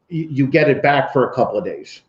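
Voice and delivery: usual speaking voice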